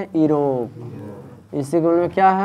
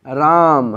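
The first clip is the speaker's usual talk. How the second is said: राम with the first sound रा hit hard and drawn out; stress on रा; 3 dB louder, much slower than usual. Shown as a demonstration. Teacher showing students where emphasis falls, रा stressed in राम. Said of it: "किरा पर जोड़ दिया".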